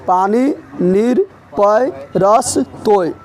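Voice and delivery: पानी, नीर, पय, रस, तोय slow, word by word; slow dictation. Shown as a demonstration. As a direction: stretch पानी and नीर with rising list tune, pausing after each. Teacher repeats list slowly for pupils.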